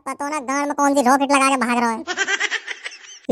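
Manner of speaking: slow, breathless laugh through the words; chuckling between gasps. Acted. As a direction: wheeze it out laughing, low. Laughing while complaining of chase.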